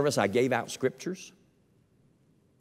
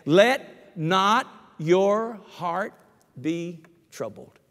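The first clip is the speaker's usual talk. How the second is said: higher, louder and slow on "Let not your heart"; preacher raising the repeated verse louder; louder slow emphasis; 6 semitones higher, 4 dB louder, much slower than usual. Shown as a demonstration. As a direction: push "Let" up and hold every word slow. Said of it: "two times in the same chapter".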